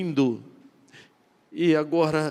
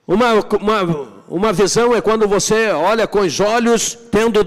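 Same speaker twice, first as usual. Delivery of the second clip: loud fervent preaching, pitch raised; preaching at full force; 6 semitones higher, 5 dB louder than usual. Acted. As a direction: preach it out, driving every line home. (pregação inflamada, voz alta e elevada)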